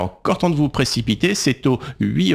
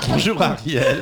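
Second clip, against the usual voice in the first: laughing; 7 semitones higher than usual. Real, not acted. Greets guest after a laugh.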